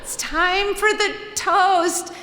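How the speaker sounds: high, slow, laughing call to the crowd; timid announcement. Retold or imitated. Narrator calling the party for the toast, high, slow and laughing. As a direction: nervously call the party to attention. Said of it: "this is me".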